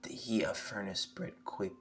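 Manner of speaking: normal talking voice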